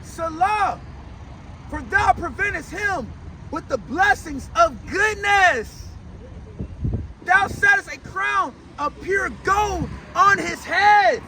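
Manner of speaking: slow, punched phrases with stretched endings; drawn-out pulpit proclamation; forceful psalm preaching. Acted. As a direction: hammer each phrase, pausing between.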